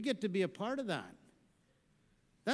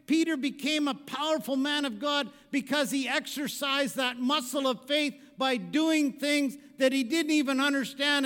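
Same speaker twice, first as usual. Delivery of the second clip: loud, higher preaching; 6 semitones higher, 7 dB louder than usual. Real, not acted. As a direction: build it up with conviction. Preaching at full force.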